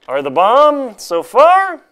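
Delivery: high, slow, relishing; enthusiastic. Real, not acted. Vlogger praising his new boots, high and enthusiastic. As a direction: savour how great the boots are.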